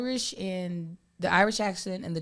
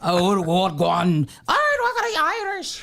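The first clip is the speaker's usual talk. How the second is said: mock Irish accent; 4 dB louder than usual. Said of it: "You went from".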